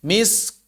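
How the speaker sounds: misk with light breathy k; soft demo. Shown as a demonstration. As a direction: end misk with a puff of air. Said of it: "demo of word ending". (contoh akhir kata misk)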